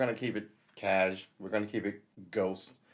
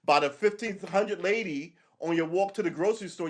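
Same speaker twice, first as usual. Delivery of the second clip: ordinary talk, nothing marked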